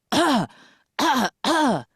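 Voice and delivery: high, loud mock coughs; short bursts of pretend coughing; imitated coughing. Man standing with knife suddenly starts coughing, imitated loudly.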